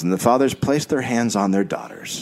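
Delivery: normal talking voice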